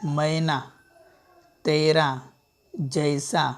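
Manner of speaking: normal talking voice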